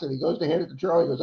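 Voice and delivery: ordinary delivery, nothing marked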